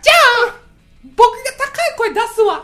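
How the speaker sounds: very high voice. Pretend. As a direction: squeak it up high.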